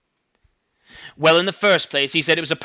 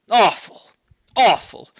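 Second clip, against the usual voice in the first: loud, high repeated cry of disgust; mother horrified by what the son-in-law said; outraged mother; 6 semitones higher, 5 dB louder than usual. Acted. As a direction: spit it out twice in outrage.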